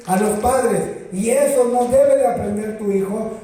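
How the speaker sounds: loud, emphatic sermon delivery; preaching at full force. Acted. Pastor warning parents about school, preaching loudly. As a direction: preach it to the congregation.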